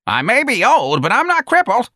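put-on high, loud indignant old woman; wild outburst. A narrator's reading. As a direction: protest wildly, voice climbing high. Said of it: "Miss Trixie said wildly".

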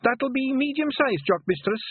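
very high Scottish pixie voice; a proud wee Feegle. A narrator's reading. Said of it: "said Not as big".

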